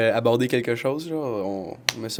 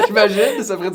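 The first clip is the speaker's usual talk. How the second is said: laughing; 11 semitones higher, 8 dB louder than usual. Real, not acted. Still laughing after joke.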